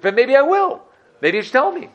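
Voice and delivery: high, urgent wheedling; eager coaxing. Acted. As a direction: coax the secret out of him. Manager coaxing the Rebbe.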